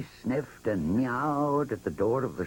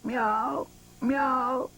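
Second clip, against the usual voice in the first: high, drawn-out cat meow; cat meowing at the door; 14 semitones higher than usual. A narrator's reading. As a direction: meow to interrupt the mice. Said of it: "cried Simpkin".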